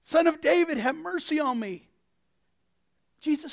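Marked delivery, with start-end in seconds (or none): 0.0-1.9 raised, high plea; beggar's loud appeal; crying out. Acted. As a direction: call out for mercy, easing toward the end. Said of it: "And he yells out".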